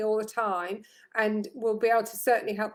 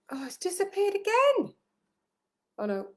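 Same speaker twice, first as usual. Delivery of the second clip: surprised; 11 semitones higher than usual. Real, not acted. Text vanishes again.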